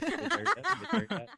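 put-on high whiny kid voice, laughing; pestering child. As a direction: whine it like a bored five-year-old in the back seat. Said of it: "sound like a five-year-old child".

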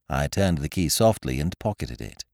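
usual speaking voice

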